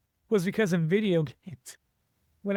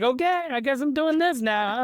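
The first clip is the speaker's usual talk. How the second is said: higher-pitched, going along with it reluctantly; bemused acceptance; 7 semitones higher than usual. Retold or imitated. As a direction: act out a raised-voice "guess I'm stuck with it". Acting out bemused acceptance of calling.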